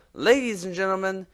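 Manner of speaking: slow grand host introduction; announcer. Acted. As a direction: build up to the big reveal.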